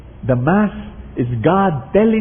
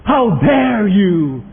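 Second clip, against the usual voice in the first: slow, louder mock rebuke; angry priest; 5 semitones higher, 4 dB louder, much slower than usual. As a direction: hurl it at the sinner, drawn out. (galit na sigaw ng pari, "How dare you?")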